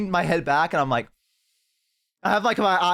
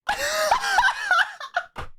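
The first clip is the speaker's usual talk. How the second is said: very high, wild cackling laughter; maniacal hyena laugh. Retold or imitated. Streamer relives losing it during the lap dance, maniacal hyena laugh. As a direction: lose it in a shrieking hyena laugh. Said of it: "I just start laughing maniacally, like my fucking hyena laugh".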